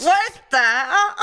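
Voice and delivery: laughing what the hell; laughing mock shock. Retelling the creepy plunge scene, laughing in mock shock. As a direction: giggle through a mock gasp.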